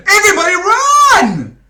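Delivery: very high, loud shout to flee; panicked shout. Acted. Director yelling to the cast to flee, panicked. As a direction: yell at everyone to run. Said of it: "shouted Peter".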